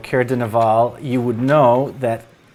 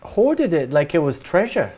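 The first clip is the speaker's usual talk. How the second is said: higher-pitched, halting villager recounting a threat; worried parent character; 5 semitones higher than usual. Acted. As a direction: play a troubled parent, pitched up, stumbling over words.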